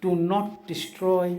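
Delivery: very slow, each word set apart; deliberate slow preaching; slow speech. Shown as a demonstration. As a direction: spell it out slowly so all understand. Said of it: "मैं slowly बात कर रहा हूँ".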